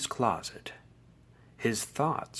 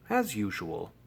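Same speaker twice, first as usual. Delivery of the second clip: plain reading voice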